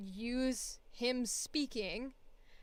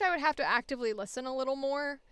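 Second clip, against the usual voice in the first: ordinary talk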